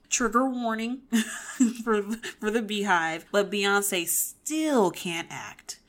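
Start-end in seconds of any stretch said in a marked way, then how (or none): 0.0-3.3 laughing through a warning; real chuckle breaking into the words. Real, not acted. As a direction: giggle between the words, slightly raised pitch. Nervous laughter before criticizing [name].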